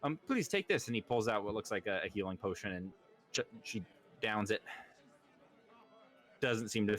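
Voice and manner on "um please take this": concerned lord. Acted. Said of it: "He's like".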